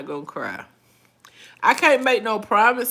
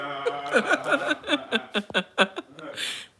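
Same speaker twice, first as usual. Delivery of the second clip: breathy giggle; laugh. Real, not acted. Laughing at chat.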